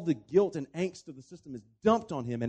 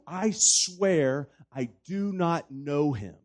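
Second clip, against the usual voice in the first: slow, emphatic sworn denial, louder; swearing an oath; 5 dB louder, much slower than usual. Retold or imitated. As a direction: retell a solemn oath, word by weighty word. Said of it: "he takes an oath".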